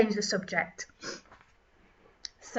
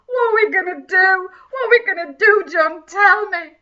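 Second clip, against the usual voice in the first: hammed-up wailing, much louder; mock panic; 7 semitones higher, 10 dB louder than usual. Host mock-pleading with John over bendy Bibles, hammed-up wail. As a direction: beg John for answers in fake despair.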